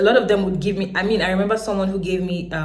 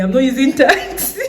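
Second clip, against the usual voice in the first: louder, higher, firmly refusing; firm refusal; 7 semitones higher, 7 dB louder than usual. Retold or imitated. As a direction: tell the driver no. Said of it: "I told the taxi man".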